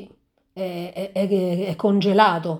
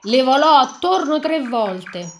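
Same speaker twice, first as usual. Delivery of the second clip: raised, louder ominous storytelling voice; dramatic story reading; 4 semitones higher, 6 dB louder than usual. A narrator's reading. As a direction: read it like a spooky fairy tale. Dramatic fairy tale reading. (lettura drammatica della fiaba)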